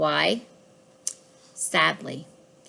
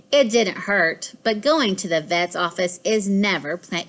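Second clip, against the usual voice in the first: ordinary delivery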